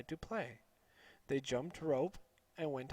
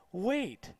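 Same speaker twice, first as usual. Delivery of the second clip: a light raised call; mild yell; 5 semitones higher than usual. A narrator's reading. Mother calling after her running son, mild yell. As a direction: call after him to wait. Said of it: "His mother yelled".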